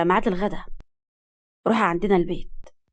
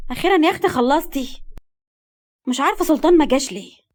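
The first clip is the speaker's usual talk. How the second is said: higher, nagging relief; exasperated mother; 5 semitones higher than usual. A narrator's reading. Mother scolds slow daughter. (أم بتلوم بنتها)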